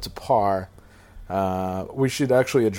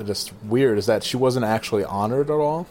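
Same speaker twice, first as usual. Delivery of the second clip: usual speaking voice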